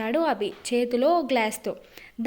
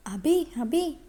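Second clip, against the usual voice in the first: lightly calling his name to wake him, higher; wife gently calling her sleeping husband awake; gentle calling; 4 semitones higher, slower than usual. A narrator's reading. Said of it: "అని అభిని తడుతూ పిలుస్తోంది".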